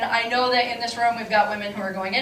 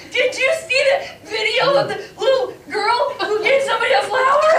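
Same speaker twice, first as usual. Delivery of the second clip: mock tearful gush; 10 semitones higher, 3 dB louder than usual. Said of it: "We're like".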